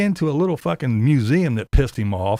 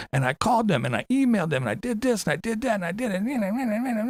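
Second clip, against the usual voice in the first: high whiny mocking Tater voice; 8 semitones higher than usual. YouTuber mocking Tater's complaints, high whiny imitation. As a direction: whine it like a self-important complainer.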